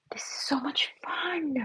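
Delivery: breathy secret glee, slowly; playful whisper. Acted. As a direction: whisper it, slow and delighted.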